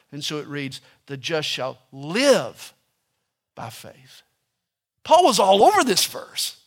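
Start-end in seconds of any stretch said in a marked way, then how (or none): 1.0-4.2 "live" drawn out slowly, the rest dropping to a hush; stress on live. Shown as a demonstration. Preacher shows Hebrews' emphasis, slow with "live" stressed. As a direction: lean on "live" and let "by faith" fall away quietly. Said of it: "the emphasis is on the word live".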